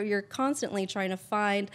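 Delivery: usual speaking voice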